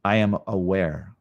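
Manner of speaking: slow, calm affirmations spaced by long silences; guided meditation. Acted. Yoga teacher leads live class through I am practice, slow and calm. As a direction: sink into each statement slowly.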